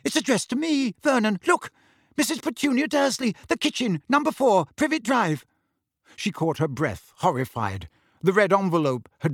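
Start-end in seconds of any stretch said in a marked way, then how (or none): 0.0-5.4 high-pitched, rattled reading; panicky, pointing out each bit of the address; shaking voice. A narrator's reading. Aunt showing her husband the address, frightened shaking voice. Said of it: "in a shaking voice".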